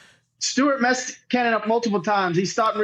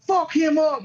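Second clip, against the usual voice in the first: harsh mock taunt; 6 semitones higher, slower than usual. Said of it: "or just tone".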